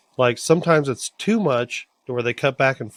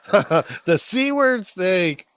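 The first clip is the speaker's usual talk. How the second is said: higher, very slow, drawn-out wail; hammed-up mock cry; 5 semitones higher, much slower than usual. Co-host riffing on a sea pun, hammed-up wail. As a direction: stretch the pun out in mock drama.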